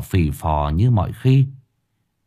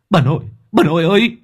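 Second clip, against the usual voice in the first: high, quick urgent call to grandma; 8 semitones higher, faster than usual. A narrator's reading. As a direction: shout for his grandmother. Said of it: "Nó kêu lên".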